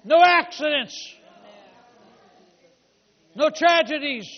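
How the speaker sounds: loud, forceful declarations of faith; full-force preaching. Real, not acted. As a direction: proclaim each line boldly to the congregation.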